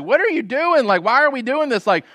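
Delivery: high, panicky voice of the frightened people; freaking out. Retold or imitated. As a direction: act out the people's alarm. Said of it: "freak out like the co-pilot".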